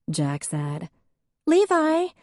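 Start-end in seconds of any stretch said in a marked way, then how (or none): 1.4-2.1 high, loud call across the house; Faith calling out. A narrator's reading. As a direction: call her husband from the next room. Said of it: "called Faith".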